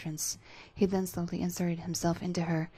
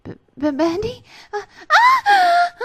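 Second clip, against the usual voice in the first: high stammering voice breaking into a loud cry; startled stammer; 17 dB louder, slower than usual. Acted. Alice startled as he reaches for her, stammering high and crying out.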